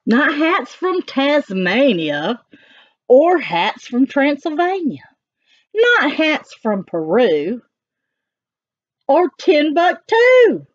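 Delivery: sing-song it for the little ones; animated read-aloud of the dog's rhymes; reading to children. Acted. Reading rhyming text.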